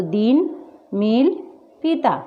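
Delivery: long, weighted ee in "दीन", "मील", "पीता"; long stressed i. Shown as a demonstration. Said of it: "इसका उच्चारण ज़्यादा जोर देकर होता है". ("दीन, मील, पीता" में ई पर ज़ोर)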